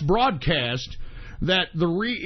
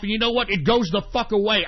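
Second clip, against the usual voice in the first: usual speaking voice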